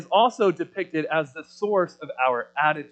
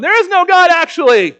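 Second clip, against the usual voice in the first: much higher and louder, a mock street-corner bark; 13 semitones higher, 13 dB louder than usual. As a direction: bark it at a passer-by, hammed up. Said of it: "barking at someone ... going".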